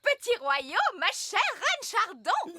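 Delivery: slow, high, formal greeting; grand welcome. Acted. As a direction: greet royalty with pomp. Grand welcome of royal guests. (accueil solennel, lent et aigu)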